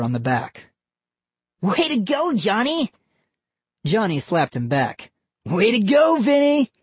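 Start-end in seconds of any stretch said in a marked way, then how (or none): 1.6-2.9 high excited cheer. Acted. Vinny cheering his brother, excited high cheer. Boys celebrating kidnap. 5.4-6.7 high, louder excited cheer. Acted. Johnny cheering Vinny back, loud excited cheer. Boys celebrating kidnap.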